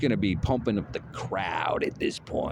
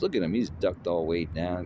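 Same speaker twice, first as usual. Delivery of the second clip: usual speaking voice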